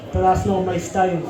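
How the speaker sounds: ordinary delivery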